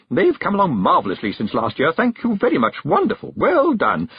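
high-pitched gushing hostess; trilling. A narrator's reading. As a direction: pile on the praise in a high trill. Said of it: "she trilled".

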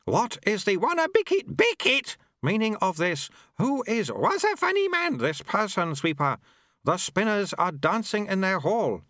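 put-on high, thin old abbot, puzzled and fussy; a frail high-pitched old abbot asking questions; abbot character voice. A narrator's reading. Abbot questions Lutze about the sweeper, in a high old voice.

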